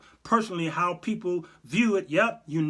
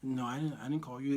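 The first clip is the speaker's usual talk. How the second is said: hushed Jesus; 3 semitones lower, 8 dB quieter than usual. Pretend. Jesus on the phone.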